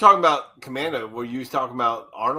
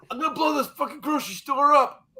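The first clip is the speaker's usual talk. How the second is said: much higher-pitched mock movie villain; movie villain threat; 11 semitones higher than usual. Quoting an eighties action movie to friends, high mock villain. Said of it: "had those stupid puns they'd say".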